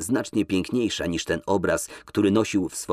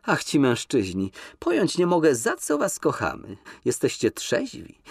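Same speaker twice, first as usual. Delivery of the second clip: higher, sweeping lilt; dreamy coquette; 7 semitones higher than usual. Acted. Nina lectures Kolski on forgetting, high and dreamy. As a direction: sigh it out, romantic and teasing.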